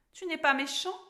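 high, uneasy question; worried. A narrator's reading. Alice checks whether the troll is nasty, worried. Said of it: "s'inquiéta Alice".

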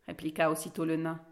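plain reading voice

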